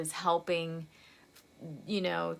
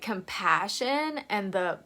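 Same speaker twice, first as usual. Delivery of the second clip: ordinary talk, nothing marked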